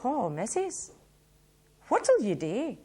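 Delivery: dramatic Glaswegian verse reading; poem recitation. Acted. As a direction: perform the poem to the room with big swoops on the refrains. Reciting the Wee Malkies poem.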